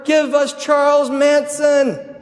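high, loud, drawn-out imitation of a mob's cry. Mob demanding a prisoner from the governor, high stretched-out shout. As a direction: holler it slowly as a whole crowd. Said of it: "the mob cries out even louder".

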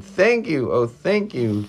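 high, loud gush of thanks; grateful cry. A narrator's reading. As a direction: cry out thanks as the relieved witch. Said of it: "the grateful witch cried".

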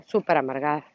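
mock-judging put-down of "Súper amargada"; judgmental label. Shown as a demonstration. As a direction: sneer "Súper amargada" like passing judgment on her. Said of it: "Ya vieron el juicio".

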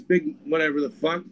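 usual speaking voice

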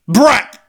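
"Brat!" yelped high and loud, ending in a p; "Brat!" spat out as "brap", sharp and high; short loud "Brat!" with a popped p at the end; Brat pronounced brap. Shown as a demonstration. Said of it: "It's the brap that makes it tricky".

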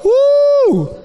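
high, loud whoop of joy; excited whoop. Real, not acted. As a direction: let out a happy cheer. Preacher cheers before the sermon.

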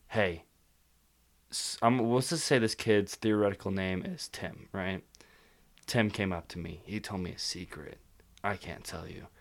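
low, hushed, secretive imitation of a friend. Friend confiding a secret to him, in a low hushed voice. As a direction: lean in and hush it like a secret. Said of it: "Why are you using that voice?".

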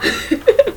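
high, soft giggling laugh. Real, not acted. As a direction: giggle helplessly at a high pitch. Cracks up at own line. (risita aguda)

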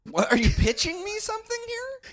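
very high, baffled question; incredulous. Real, not acted. Co-host suspects he is being pitched a movie, incredulous. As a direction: jump way up in disbelief. Co-host doubts the turkey entry.

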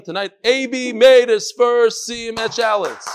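proud announcement. Real, not acted. Reader announces a listener's first siyum to the group, proud announcement. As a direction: proclaim it slowly and loudly, word by word.

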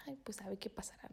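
whispered to herself, unsure; a soft whispered aside; puzzled whisper. Retold or imitated. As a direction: breathe it out like a private thought. Inner thought after overheard gossip.

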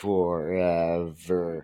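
low, very slow mock delivery; drawn-out word. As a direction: stretch every syllable out for comic effect. Joking drawn-out echo of a word.

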